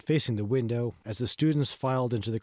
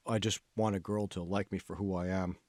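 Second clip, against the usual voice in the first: a lightly voiced young man, low and softer, wishing; wistful Kevin; 5 semitones lower, 5 dB quieter than usual. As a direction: say it low and quiet, half to himself. Kevin's voice, pleading.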